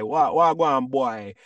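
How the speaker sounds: put-on light Jamaican lilt on wagwan boy; lightly voiced Jamaican greeting. Shown as a demonstration. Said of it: "in Jamaica, we might say".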